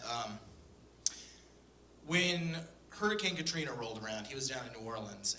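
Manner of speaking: normal talking voice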